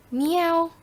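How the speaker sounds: high, rising meow; cat meow. Shown as a demonstration. Teacher demonstrating animal sounds, high-pitched cat meow. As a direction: mew like a cat. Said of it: "Animal sounds".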